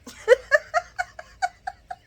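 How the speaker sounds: long high burst of laughter. Real, not acted. Laughing at Sonny's answer, high long laughter. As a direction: crack up at it. Cracking up at recap.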